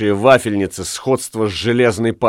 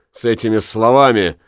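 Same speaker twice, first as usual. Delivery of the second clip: ordinary delivery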